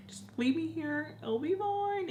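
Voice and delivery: high, mock-tough whine; acting chingona. Not wanting to be alone, mock chingona act. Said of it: "I acted like chingona".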